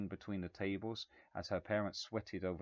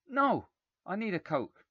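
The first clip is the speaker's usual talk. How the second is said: high, loud whine; spoilt boy; 12 semitones higher, 8 dB louder than usual. A narrator's reading. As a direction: insist like a brat. Spoilt boy demanding Coke.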